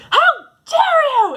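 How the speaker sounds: Anne yelling high and loud, shocked fury; outraged cry. Retold or imitated. Anne rounding on the boy who called her Carrots, furious shout. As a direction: fling it at him, high and loud. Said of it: "she says".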